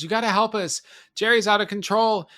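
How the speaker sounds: high-pitched pleading for help; mock desperate cry at the door; urgent plea. Evil Ed begs Peter for help at his door, urgent plea.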